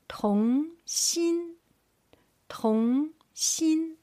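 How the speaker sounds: 童心 with 童 rising and 心 held high and level; drawn-out rise on 童, then high steady 心; second tone, first tone. Shown as a demonstration. Teacher drilling tone pairs for learners, 童 rising, 心 high level. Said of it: "Starting from second tone".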